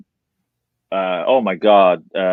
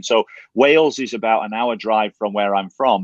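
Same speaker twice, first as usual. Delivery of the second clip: usual speaking voice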